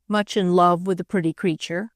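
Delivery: plain reading voice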